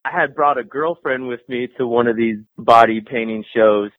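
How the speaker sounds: usual speaking voice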